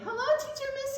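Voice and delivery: high, squeaky puppet greeting; puppet elephant voice. Pretend. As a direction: give the toy elephant a chirpy little voice. Puppet elephant greets Teacher Missy.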